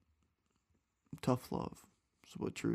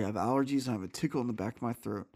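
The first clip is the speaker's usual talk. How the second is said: usual speaking voice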